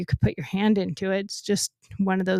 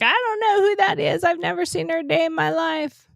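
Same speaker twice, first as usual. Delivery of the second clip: much higher voice, mock innocent denial; mimicked protesting suspect; 7 semitones higher than usual. Hog-tied suspect denying he knows her, mimicked high protest. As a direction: imitate his protest in a high pitch.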